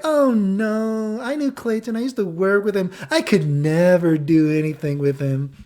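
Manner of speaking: high, drawn-out mock protest; imitated coy girl. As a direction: protest coyly in a put-on girly voice.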